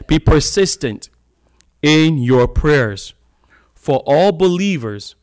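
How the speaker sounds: loud, slow, drawn-out verse; emphatic preaching. Acted. Preacher urging his church to pray, loud and slow. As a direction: lean into each word of the verse.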